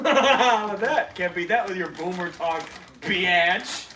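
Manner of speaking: laughing trash talk. Acted.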